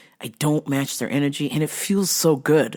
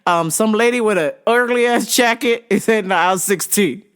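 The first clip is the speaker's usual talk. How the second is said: mock store page; 6 semitones higher, 3 dB louder than usual. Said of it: "So I was just like".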